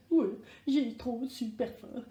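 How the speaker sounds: ordinary delivery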